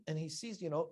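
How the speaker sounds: ordinary delivery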